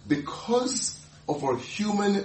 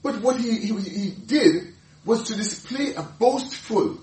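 ordinary delivery

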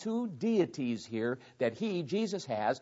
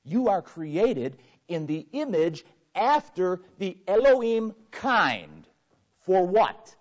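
slow, loud, high proclamation; preacher building to a climax; preaching at full force; 5 semitones higher, 9 dB louder, slower than usual. Real, not acted. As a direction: stretch every word to the Elohim.

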